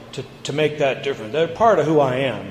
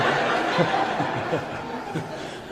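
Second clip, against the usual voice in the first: soft breathy laugh; 2 semitones higher, 6 dB quieter than usual. Real, not acted. Laughs at own story.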